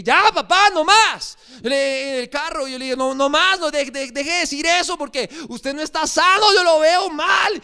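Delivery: high, loud pleading with Dad; exasperated outburst. Retold or imitated. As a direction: cut him off, upset and loud. Son snaps at ailing father.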